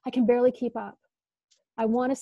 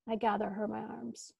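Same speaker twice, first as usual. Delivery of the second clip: normal talking voice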